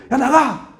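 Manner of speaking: put-on a dad's high, sharp yell at his kids; angry dad yell. Father yelling at his kids in frustration, a sharp high shout. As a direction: snap at the kids to get out, pitched high. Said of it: "하도 화가 나가지고".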